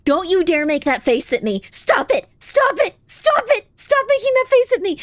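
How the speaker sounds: high, squeaky mock-stern stop it; mock scolding. Streamer scolding chat's emote faces, high mock anger. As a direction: scold them like naughty kids, half-joking.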